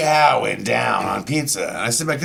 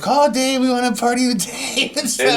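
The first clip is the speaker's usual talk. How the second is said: excited party call; 10 semitones higher than usual. Retold or imitated. Imitating aunt and friend excited.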